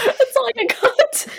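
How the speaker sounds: lightly voiced Scottish accent, high and slowed, laughing; mock Scottish. Teasing a friend's Scottish attempt, light mock Scottish accent. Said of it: "that's the one".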